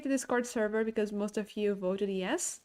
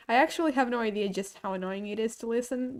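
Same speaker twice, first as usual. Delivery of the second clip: ordinary talk, nothing marked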